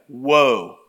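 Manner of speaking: loud, drawn-out WOE; group-prompt woe. Shown as a demonstration. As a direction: lead the room in one big woe. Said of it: "let's say this together".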